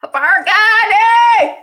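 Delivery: high, loud, slow shouted greeting; yell. Real, not acted. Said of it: "I mean, why I yell".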